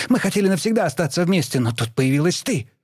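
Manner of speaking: high, fast furious outburst; rushed angry accusation. A narrator's reading. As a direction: spit the accusation out quickly. Daughter accuses mother.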